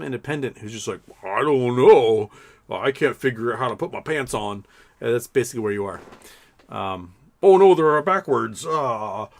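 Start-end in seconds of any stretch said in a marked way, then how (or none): 1.2-4.7 mock cluelessness. Mocking a clueless independent voter. 7.4-9.3 loud, drawn-out helpless wail; mock dismay. Clueless voter finds pants on backwards, mock dismay.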